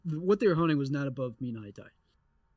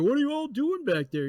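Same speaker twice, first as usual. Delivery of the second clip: mocking the boater; 10 semitones higher than usual. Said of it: "going like".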